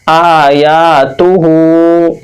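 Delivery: "आ या तु हू" slowly, holding आ, या and हू; measured syllable-by-syllable "आ या तु हू"; stretched long vowels. Shown as a demonstration. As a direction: draw out aa, yaa and hoo, short तु in "आ या तु हू". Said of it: "बराबर खींचकर पढ़ेंगे".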